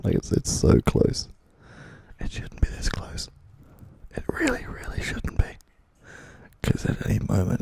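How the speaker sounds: close whisper. Real, not acted.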